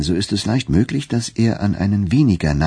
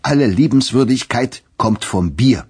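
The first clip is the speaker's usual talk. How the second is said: higher, emphatic bluster; loud interjection; 6 semitones higher, 4 dB louder than usual. A narrator's reading. Turkey blames the beer, loudly interrupting. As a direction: butt in and proclaim it. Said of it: "mischte sich Turkey lauthals ein".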